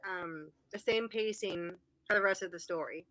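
ordinary talk

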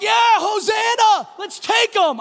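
rally the crowd to take Rome; rousing crowd cheer. Pretend. Crowd cheering Jesus in, slow rousing shout. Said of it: "They're like".

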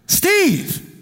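high, mock-amazed dude voice; imitating astonished old acquaintance. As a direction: play up the drawn-out, incredulous greeting. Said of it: "he goes".

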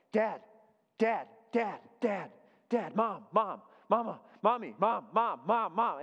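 put-on a kid calling Dad and Mom over and over; nagging child. Kid nagging parent for attention.

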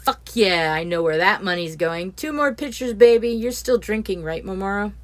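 loud, high, crowing over the winnings; excited gloating. Acted. Neil crowing to the table about his winnings, loud and excited. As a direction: brag loudly about the cash.

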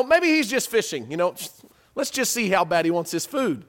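ordinary delivery

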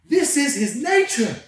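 rising into a full excited preach; fired up. Real, not acted. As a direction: tell it like you're lighting up the room. Excited preaching about God.

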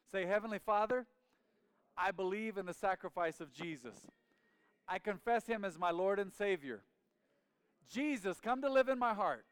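loud, high prayer in separated phrases; leading prayer aloud. Real, not acted. Preacher leading everyone in prayer together, loud and fervent. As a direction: proclaim each phrase for repeating.

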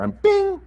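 mock ding. Imitating phone notification.

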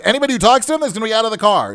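fast, high-pitched threat; rapid mock-angry decree; retold outburst. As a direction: imitate the driver laying down the law.

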